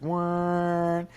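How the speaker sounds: high nasal-free wah of a dying engine; engine bog noise. Retold or imitated. Imitating the bike bogging, high mock wah. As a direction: mimic the engine choking out.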